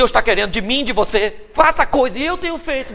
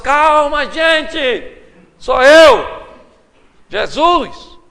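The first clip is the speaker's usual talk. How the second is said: loud reassuring call across the water; calling out to calm them; 5 semitones higher, 7 dB louder, much slower than usual. Retold or imitated. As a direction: call out to settle the frightened crew. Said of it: "gritou pra acalmar". (chamado alto para acalmar)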